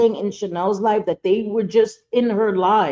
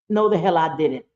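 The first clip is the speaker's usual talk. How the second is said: ordinary delivery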